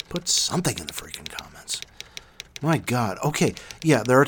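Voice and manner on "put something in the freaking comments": exasperated whisper. Real, not acted. Host begging listeners to comment, exasperated whisper. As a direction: whisper it, fed up, under the breath.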